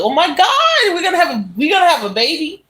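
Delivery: high, giddy squeal with a laugh; overjoyed, laughing through the news; excited husband. Retold or imitated. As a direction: gush like a thrilled new dad. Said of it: "was so excited".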